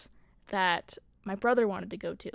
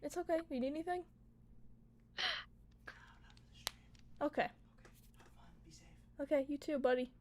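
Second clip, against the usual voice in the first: soft, higher voice to a little brother; quiet aside; 4 semitones higher, 14 dB quieter, faster than usual. Real, not acted. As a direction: speak softly off to the side, pitched up. Aside to brother during stream.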